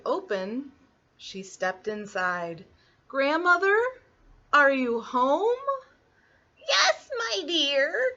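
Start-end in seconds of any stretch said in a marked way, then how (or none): 3.1-5.6 high, questioning rise; little girl calling. Acted. Little Red looking for grandmother in the cottage, questioning girlish voice. 6.6-8.1 very high, scratchy fake granny. A narrator's reading. Wolf posing as grandmother from the bedroom, scratchy high voice. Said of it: "came a scratchy voice".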